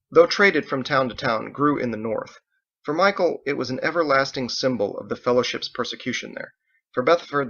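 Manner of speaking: ordinary delivery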